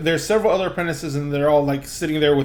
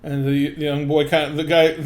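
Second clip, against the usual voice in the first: ordinary talk, nothing marked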